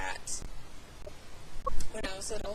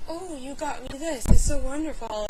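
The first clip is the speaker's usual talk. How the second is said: higher, gushing present thanks; acted excitement; 4 semitones higher, 6 dB louder than usual. Thanking someone for a present, acted excitement. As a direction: gush over a gift, playacted. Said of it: "how excited I am".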